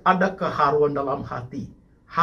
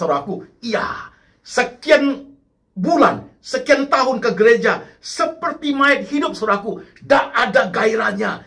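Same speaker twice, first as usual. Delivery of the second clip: high, insistent sermon rebuke; impassioned preaching; 9 semitones higher, 5 dB louder than usual. Real, not acted. Preacher warning the congregation of stagnant faith, impassioned. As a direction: drive the warning home with a lifted, forceful voice.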